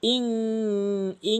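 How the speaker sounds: ইং with a long nasal hum on the ending; drawn-out nasal ইং; ghunnah demo. Shown as a demonstration. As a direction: hold the ng of ইং in the nose. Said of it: "এভাবে".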